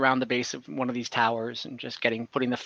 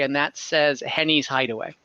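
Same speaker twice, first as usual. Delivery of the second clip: normal talking voice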